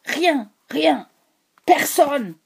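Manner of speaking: high, loud anguished outburst; a wailing cry of rage; wailing violence. A narrator's reading. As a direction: moan it out violently. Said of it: "avec une violence gémissante".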